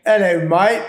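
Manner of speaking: echoed English accent on request; British accent. Shown as a demonstration. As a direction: toss off a cheery English hello. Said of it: "can you give us a little bit of that".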